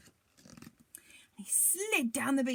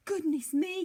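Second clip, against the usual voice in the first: higher, louder alarmed giantess; startled cry; 6 semitones higher, 5 dB louder than usual. A narrator's reading. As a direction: gasp it out in fright. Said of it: "cried the giantess".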